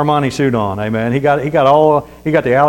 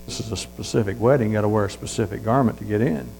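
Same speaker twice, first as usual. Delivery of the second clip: quiet insistence; 5 semitones lower, 8 dB quieter than usual. Acted. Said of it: "He goes".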